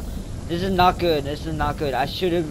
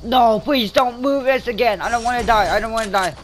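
higher, louder desperate pleas; panicked begging; 7 semitones higher, 5 dB louder than usual. Real, not acted. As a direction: plead like the end is near.

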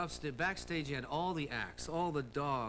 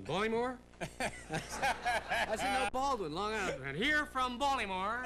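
higher, slower mock host mishearing the town; Ed Sullivan impression; 7 semitones higher, slower than usual. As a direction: playfully mimic the host getting the town wrong. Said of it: "He was like that".

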